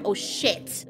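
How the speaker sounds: breathless whisper, awed dread; whispered oh shit. Retold or imitated. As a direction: whisper it like you're hiding. Claire sees the kill.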